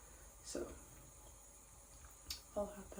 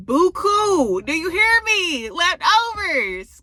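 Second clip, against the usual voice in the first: loud high exclamation; 11 semitones higher, 16 dB louder, much slower than usual. Real, not acted. Emphatic leftovers exclamation.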